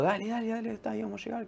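high, urgent mock pleading of panicked friends; desperate friends. Amigos desesperados en el auto. (imitando a amigos desesperados, agudo y urgente)

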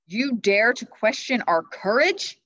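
slow, loud offended retort; angry. Acted. King Arthur answering the knight's taunt, angry. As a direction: bristle with anger at the insult. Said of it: "in a low, angry voice".

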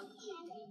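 short high giggle; a light little laugh; girlish giggle. Acted. Girl laughs.

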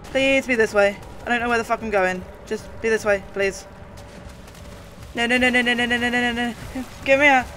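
panicked high screaming; terrified shrieks while fleeing; being chased. Real, not acted. I hate chase scenes.

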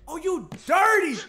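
slow, high, loud taunting shout; angry shout. Acted. Boxer yelling at his rival, slow furious shout. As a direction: bellow it at the opponent like an insult. Trash talk in boxing game.

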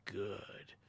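soft low evil murmur. Acted. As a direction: murmur with dark satisfaction. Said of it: "it murmured".

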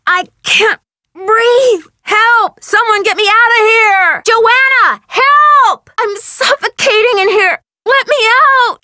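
high, desperate cries for help; suffocating panic. Acted. Trapped and calling Joanna for help, suffocating panic. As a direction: cry out as the air runs out, building to a scream. I'm having trouble breathing.

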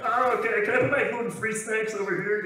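high-pitched mock schoolboy voice; allergy kid. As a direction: play the awkward kid with special snacks. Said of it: "I was like that guy in your grade".

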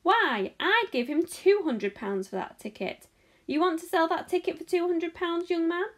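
loudly outbid the offer; brisk, insistent crowd voice; shouting. A narrator's reading. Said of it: "shouted a woman".

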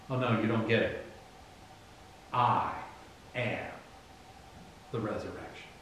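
low, soft, with pauses between the words; hushed and deliberate, voicing Jesus; quiet correction. Preacher voicing Jesus correcting Martha, quiet and deliberate.

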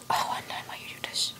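breathy dramatic whisper; whispered lament. Pretend. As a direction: whisper it like a tragic plea. Whispered lament at game character.

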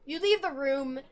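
usual speaking voice

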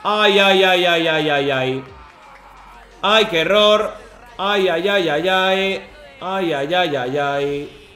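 drawn-out slow lament; anguished groans. Real, not acted. Streamer lamenting his team's error, slow groaning. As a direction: moan each ay in dismay. After conceding a goal.

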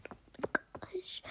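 breathy unvoiced whisper. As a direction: whisper oh my gosh. Whispered repeat.

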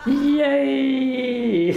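very high, cried-out yay; evil witch whooping in triumph; gleeful shriek. Acted. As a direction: burst in with wicked glee.

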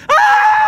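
hoge schreeuw van pijn; pijnkreet. Real, not acted. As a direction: gil het uit van de pijn. Strip eraf getrokken. (luide pijnkreet)

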